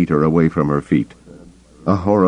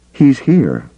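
alarmed; 8 semitones higher, 4 dB louder than usual. Acted. Mara alarmed, C'baoth near.

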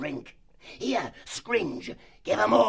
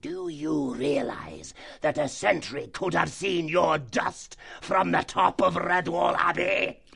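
higher, slow, shouted fox tirade; furious fox chief; 8 semitones higher, slower than usual. Acted. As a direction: dress him down with slow fury.

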